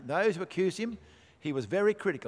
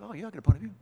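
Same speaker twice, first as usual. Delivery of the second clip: offhand, breezy shrug of a voice, a bit higher; mock casual believer; 4 semitones higher, 3 dB louder, much faster than usual. Preacher mocking a lukewarm believer, breezy and offhand. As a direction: toss it off like a lukewarm churchgoer. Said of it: "it can sound like that".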